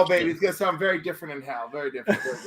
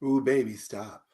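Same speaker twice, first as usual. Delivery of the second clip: sexy voice; 8 semitones lower than usual. Pretend. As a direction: purr it low and seductive. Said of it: "say it in a sexy voice".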